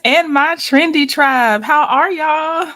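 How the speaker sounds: loud, drawn-out welcome to the chat; hyped stream greeting. Real, not acted. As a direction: call out to your tribe, big and slow. Livestream host greets her audience.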